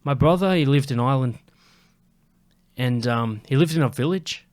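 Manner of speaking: a hint of mock Irish; put-on Irish accent. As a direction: begin a tale as the Irishwoman, accent half kept. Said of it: "I gotta keep the accent".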